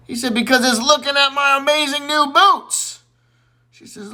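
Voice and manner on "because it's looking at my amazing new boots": much higher, louder proud boast; a high hammed-up brag about the boots; boastful Johnny.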